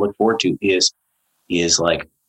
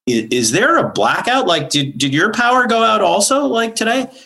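higher, puzzled neighbor asking over the fence; curious question about a blackout; lightly voiced neighbor; 13 semitones higher than usual. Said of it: "said to me, like".

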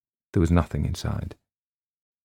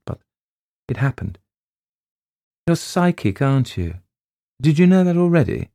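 lightly voiced brisk headmistress, higher; a school head questioning, curious; 7 semitones higher than usual. Headmistress questioning the new teacher, lightly voiced brisk. Voicing Miss Leonard in dialogue.

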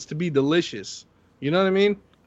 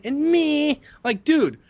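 high, snooty mimic of critics; mocking hater voice; 12 semitones higher, 3 dB louder, slower than usual. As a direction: sneer it like a snob. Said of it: "Everyone's always gotta be like".

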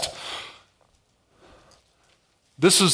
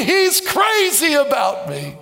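preaching at full force; 8 semitones higher, slower than usual. Real, not acted. Preacher shouting God's love to the church, full force. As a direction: thunder it out to the church.

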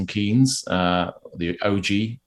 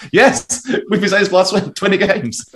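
much higher and louder, a hammed-up mock cry of frustration; mock exasperated exclamation; 12 semitones higher, 6 dB louder than usual. To his co-hosts, mocking how obvious the flaws were, with hammed-up exasperation. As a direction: blurt it like a fed-up fan who called it all along.

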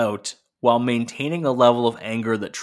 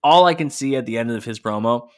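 normal talking voice

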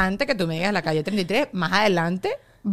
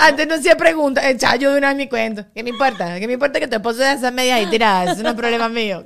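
loud, raised-pitch mock self-importance; brash dismissiveness; 3 semitones higher, 5 dB louder than usual. Talker brushing off a friend's husband troubles, brashly dismissive. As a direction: bulldoze over the other person's story. Parodies her old self not listening.

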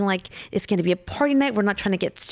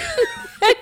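high laugh; 13 semitones higher than usual. Real, not acted. Laughing at the retold flattery.

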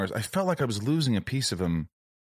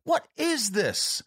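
mock yell, pitched way up, words stretched out; imitated scream; slower than usual. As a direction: act out his appalled cry in a high, drawn voice. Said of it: "he'd scream at us".

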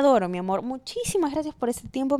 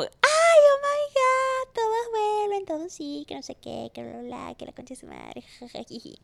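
much higher, very slow squeal that fades to a giggle; giddy screaming; 8 semitones higher than usual. Retold or imitated. Host reliving her squealing over a crush's like, giddy. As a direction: shriek with delight then dissolve into giggles. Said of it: "Y yo gritando".

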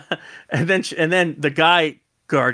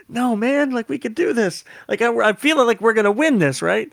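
much higher excited hush; eager; 8 semitones higher than usual. Retold or imitated. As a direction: sell the hope, high. Eager whisper to partner.

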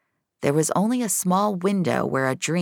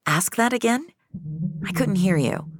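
puzzled, higher voice straining to hear; higher-pitched confused question; Finch, higher; 5 semitones higher than usual. A narrator's reading. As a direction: ask again, leaning in to catch her. Finch asks the dream woman.

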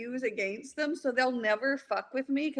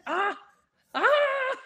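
high-pitched imitated child scream; mock toddler scream; 12 semitones higher than usual. Mom imitating her three-year-old screaming at a man, mock scream. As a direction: let out high mock shrieks. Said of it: "he just started screaming at the guy".

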